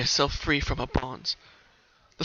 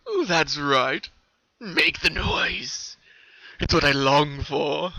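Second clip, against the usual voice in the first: slow, louder, relishing sadistic voice; creepy colt; 2 semitones higher, 5 dB louder, much slower than usual. Acted. The colt gloating over the screaming sister, slow. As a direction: drag it out, savoring her fear.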